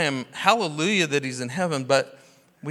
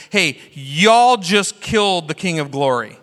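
preaching Peter; 2 semitones higher, 7 dB louder than usual. Retold or imitated. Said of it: "he preaches this powerful sermon".

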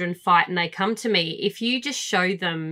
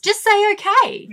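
exasperated high; 13 semitones higher, 4 dB louder than usual. Retold or imitated. Exasperated retelling.

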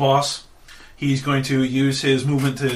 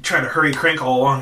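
ordinary talk

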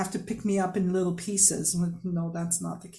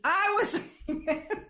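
laughing; 10 semitones higher than usual. Real, not acted. Laughing while telling it.

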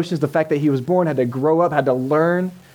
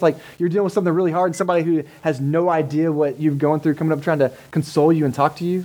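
usual speaking voice

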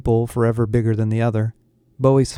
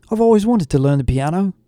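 eager excitement; 7 semitones higher, faster than usual. A narrator's reading. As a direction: blurt it out, thrilled at the idea. Jade thrilled about learning piano.

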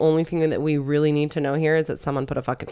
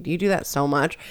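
usual speaking voice

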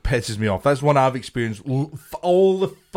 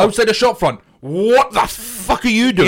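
venting at shop-front blockers; exasperated rant; 8 semitones higher, 5 dB louder than usual. Retold or imitated. Friend rants about shoppers stopping outside a shop, with loud higher outrage.

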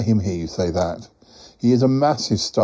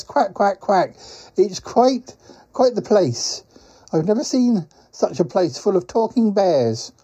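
higher character voice, marvelling at the place; chatty quacking creature; 7 semitones higher than usual. Acted. Quacking traveller tells Paul about talking bears, high chatty creature voice.

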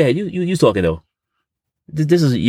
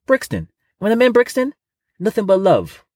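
pitched-up triumphant boast; high excited reveal; 10 semitones higher, slower than usual. Acted. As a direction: lift it high with delight.

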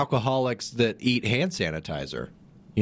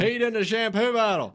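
mock parent with a Southern accent; 11 semitones higher than usual. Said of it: "having a Southern accent".

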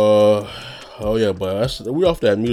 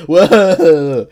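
laughing whoa; 8 semitones higher, 10 dB louder than usual. Real, not acted. Laughing at himself.

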